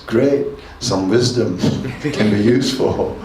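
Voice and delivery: usual speaking voice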